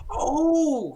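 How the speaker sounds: high, loud startled "Oh". Acted. Flustered priest startled by visitors. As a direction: jump in surprise as the priest.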